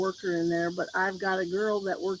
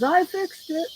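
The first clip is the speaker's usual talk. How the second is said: higher, louder quoted announcement; imitating older man; 8 semitones higher, 5 dB louder, slower than usual. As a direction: lightly act out a man announcing success. Retold older gentleman announcing fix.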